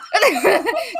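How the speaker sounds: high burst of real laughter; laughing. Real, not acted. Laughing at her own cheese pun.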